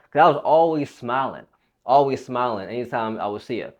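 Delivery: normal talking voice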